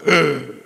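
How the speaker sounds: short loud groan of mock dismay. Old runner groaning at her weekly mileage, mock dismay. Reacting to thirty-five miles.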